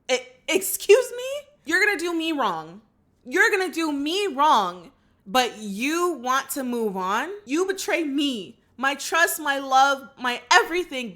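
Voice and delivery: high, loud, incredulous outrage; indignant outburst. Acted. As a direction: build an offended tirade, hitting each betrayal. Mock outrage at the cheaters.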